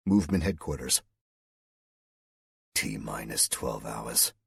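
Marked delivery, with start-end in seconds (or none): 2.7-4.3 low, soft, slow mutter; mumbled to himself. A narrator's reading. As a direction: murmur it under your breath. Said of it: "the colonel mumbled to himself".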